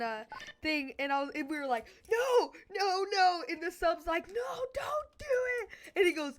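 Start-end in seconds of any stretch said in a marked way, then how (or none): 2.1-3.4 raised, higher-pitched pleading; mock alarmed cries. Class begging Mason not to pull it, mock alarm. 4.3-5.7 high-pitched wailing substitute; mock panicked plea. Substitute begging Mason to stop, high mock panic.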